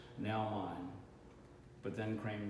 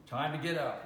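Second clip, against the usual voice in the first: higher, louder rousing voice; insistent wake-up call; 5 semitones higher, 5 dB louder than usual. Acted. Grandfather repeats his wake-up call.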